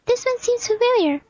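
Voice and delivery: high squeaky voice, suspicious of a newcomer; tiny sidekick piping up, wary; pitched far up, questioning at the end; high-pitched game companion. Acted.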